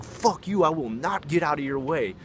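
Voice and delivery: high-pitched, loud furious refusal; going nuts. Retold or imitated. Enraged roommate refusing to let Todd pass, going nuts. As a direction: act out a raging man standing his ground. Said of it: "he was going nuts".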